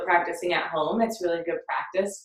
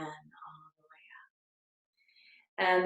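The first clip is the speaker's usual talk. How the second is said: very slow, soft whisper; drawn-out, softly whispered meditation cue; whispered guidance; 6 dB quieter, much slower than usual. Acted. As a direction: let the words stretch out into a hushed whisper. Guiding imagined light upward.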